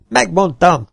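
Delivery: loud, high I-told-you-so shout; triumphant cry. A narrator's reading. Mark shouts to the crew that he was right. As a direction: crow it out in triumph. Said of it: "kiáltott fel Mark".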